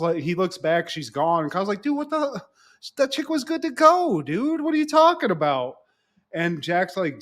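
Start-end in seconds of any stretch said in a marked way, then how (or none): 1.8-5.7 high-pitched, excited disbelief from a bro. Retold or imitated. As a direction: squeal it like a stunned bro. Kyle reacting excitedly to Jack.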